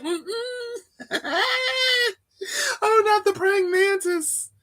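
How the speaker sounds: high squealing laugh-cry; mock terror. Refusing the teacher's praying mantis, high laughing shriek. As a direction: shriek and laugh as if it's crawling on you.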